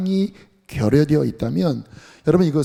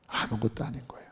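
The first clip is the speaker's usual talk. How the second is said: 아무것도 whispered, much softer and quicker; whispered echo; 5 semitones lower, 12 dB quieter, faster than usual. Real, not acted. Preacher repeats to the congregation in a hushed whisper.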